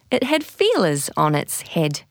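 ordinary delivery, nothing marked

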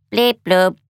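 robot bleeps at normal volume; robot bleeping. A narrator's reading. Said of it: "said Tidybot".

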